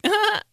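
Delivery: sudden high squeal; high yelp of shock. Retold or imitated. Kid hit by the sprinkler, high yelp. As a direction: yelp like the water hit hard.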